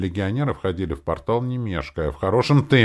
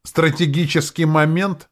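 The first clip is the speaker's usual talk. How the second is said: mocking; 8 semitones higher, 4 dB louder than usual. A narrator's reading. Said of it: "Он насмешливо прищурился".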